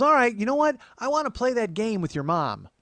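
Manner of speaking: high, loud imitated aunt; eager aunt. Aunt wanting a game with mom, eager aunt voice. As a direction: announce your plan as the aunt.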